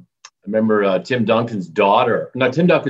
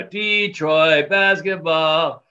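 drawn-out arena announcer call; slow, higher-pitched PA catchphrase, stretching the vowels; Mason; 6 semitones higher, 3 dB louder, much slower than usual. Retold or imitated. Detroit PA announcer's famous catchphrase, imitated in a drawn-out call. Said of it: "he's pretty famous for".

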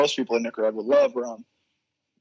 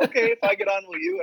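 teasing local guy. Retold or imitated. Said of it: "And he's like".

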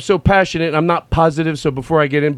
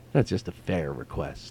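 soft and calm; 13 semitones lower, 15 dB quieter than usual. Retold or imitated. Voicing a reasonable person.

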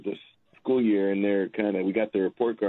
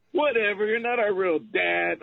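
put-on higher, bratty teen voice; mock stepson; 10 semitones higher than usual. Stepdad mimicking his stepsons, higher and bratty. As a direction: sneer it like a sulky stepkid. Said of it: "they tell you sweet things like".

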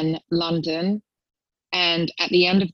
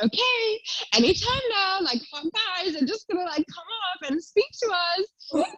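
high, eager, imitating her past self; mock hopeful thought; 13 semitones higher than usual. Retelling to the host her hopeful wait for guys, high and eager. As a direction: squeal out a hopeful expectation.

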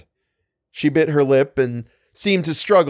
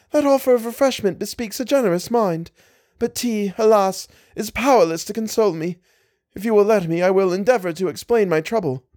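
put-on a higher, pleading young woman's voice; young lady beseeching a stranger, higher and imploring; distressed young lady; 7 semitones higher than usual. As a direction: voice the lady in earnest distress, pitched up.

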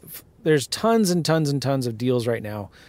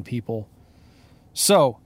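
usual speaking voice

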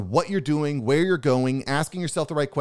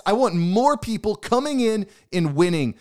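higher and louder, eager retold cheer; excited; 7 semitones higher, 4 dB louder than usual. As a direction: push it up with excitement. Retelling his own excited reply.

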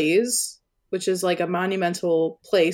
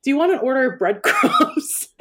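laughing quote; 7 semitones higher than usual. Retold or imitated. Quoting friend while laughing.

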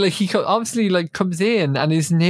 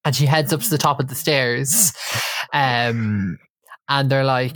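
usual speaking voice

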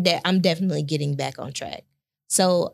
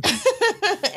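high burst of laughter; 4 dB louder than usual. Real, not acted. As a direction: laugh out loud. Wife laughing at husband.

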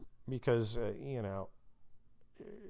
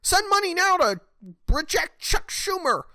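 much higher, louder whiny pleading voice; hammed-up desperate donation plea; mocking fundraising text; 7 dB louder than usual. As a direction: parody a nagging campaign text in a shrill, high whine. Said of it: "And it's like".

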